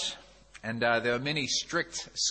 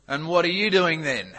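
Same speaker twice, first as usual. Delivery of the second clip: lightly voiced burly man demanding an answer; mock challenge; 3 semitones higher, 6 dB louder than usual. Burly man challenges newcomer in joke.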